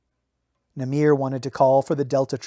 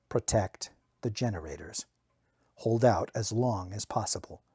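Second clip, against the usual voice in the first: "Protect the generators" softer and lower, inward; quiet echo; 4 semitones lower, 7 dB quieter than usual. A narrator's reading. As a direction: murmur the order to yourself. Order echoed in narrator's mind.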